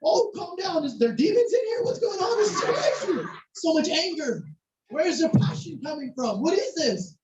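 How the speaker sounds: high-pitched, incredulous reaction; bewildered alarm. Retold or imitated. Baffled visitor reacting to screaming soccer fans, high and alarmed. Retold shock at raging crowd.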